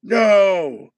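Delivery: high, loud protest; loud desperate defiance; anguished cry. Acted. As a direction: shout no and refuse him. Cries out against the killing.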